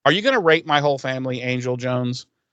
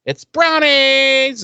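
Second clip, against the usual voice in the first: slow, sing-song, rising high on brownies; drawn-out cheery announcement, climbing up; mock sweet hostess; 8 semitones higher, 4 dB louder, slower than usual. Mom presenting dessert to the family, slow and sweet. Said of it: "she's like".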